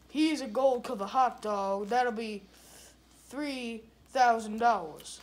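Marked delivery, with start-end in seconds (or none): none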